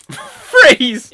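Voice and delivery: very loud, high shout; called-out command. Real, not acted. Host halting the improv scene, loud high shout. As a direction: shout it over the laughter.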